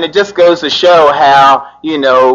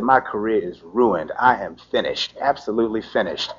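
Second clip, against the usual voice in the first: despairing; 4 semitones lower, 10 dB quieter than usual. Retold or imitated. Despair after arrest.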